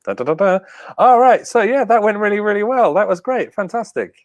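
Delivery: imitating a chirpy host signing off, pitched high; host wrapping up. Host signing off his stream, chirpy and high. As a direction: play the relieved stream host. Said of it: "It was like".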